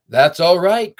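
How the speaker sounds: loud friendly call. A narrator's reading. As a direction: call after the car. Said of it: "called the big trailer truck".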